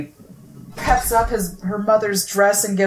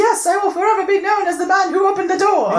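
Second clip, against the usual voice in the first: sassy da Vinci; 12 semitones higher than usual. Retold or imitated. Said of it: "he's so sassy".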